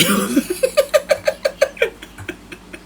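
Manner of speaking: long, high cackling laugh; high cackle. Real, not acted. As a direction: laugh hard at own story. Laughs at his own joke.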